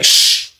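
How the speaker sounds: drawn hushing sound; shushing. Retold or imitated. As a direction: imitate the cellmate's shush. Cellmate shushes them, retold.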